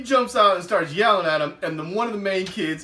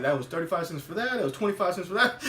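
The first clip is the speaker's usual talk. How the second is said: quick counting; 2 semitones lower, 5 dB quieter, faster than usual. Retold or imitated. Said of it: "he was like".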